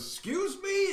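high-pitched, affronted question; mock affronted. Senator answering a threat, high mock affront. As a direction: squeak it up high in mock disbelief.

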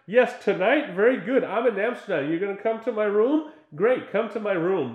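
high, bright pretend chatter into a phone; fake phone call. Girl fakes a phone call to warn the roommate, high and loud. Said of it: "pretends to call on the phone".

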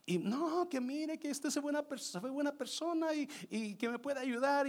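high, whining begging; retold crying woman. Crying woman begs the instructor to be kind, imitated high pleading. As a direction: imitate her tearful coaxing. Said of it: "so está llorando".

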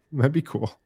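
said through a small laugh. Real, not acted. Host jokes about Putin watching his episode, laughing lightly. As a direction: chuckle the words out.

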